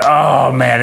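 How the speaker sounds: high, loud mock dismay; dad's dread. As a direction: groan of a dad regretting it. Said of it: "I'm, like, going".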